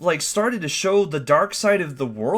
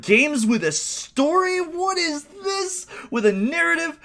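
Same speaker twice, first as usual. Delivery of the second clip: amazed disbelief; 12 semitones higher than usual. Retold or imitated. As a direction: gush like a kid who can't believe it. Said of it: "it was like".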